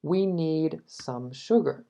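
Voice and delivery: slow, careful English read; slow model sentence. Shown as a demonstration. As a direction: teach it slowly. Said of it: "model sentence". (frase modelo, lenta)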